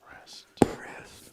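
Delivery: breathy repeated whisper. Pretend. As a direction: whisper the word like a spa guide. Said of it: "one of those meditation episodes".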